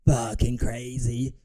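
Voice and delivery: slow, drawn-out incredulous repeat; slow mocking echo. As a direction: drag each word out in disbelief. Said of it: "You have the champ of your division saying".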